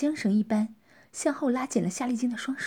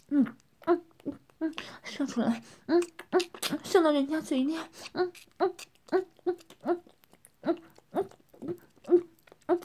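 higher, muffled mumbles through a full mouth; muffled mumbling; 6 semitones higher than usual. Acted. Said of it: "边吸着阴茎边含混地说". (含混地说)

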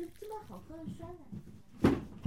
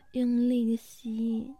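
usual speaking voice

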